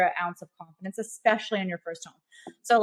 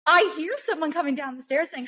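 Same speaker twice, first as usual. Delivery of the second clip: normal talking voice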